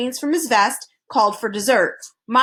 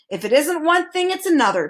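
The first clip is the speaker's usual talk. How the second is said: chiding; 6 semitones higher than usual. A narrator's reading. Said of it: "chided the minister".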